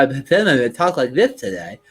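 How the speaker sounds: slow, lisping demo; thick-tongued lisp, drawn out; bitten-tongue lisp. Shown as a demonstration. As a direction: show how a bitten tongue garbles speech. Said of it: "I bit my tongue".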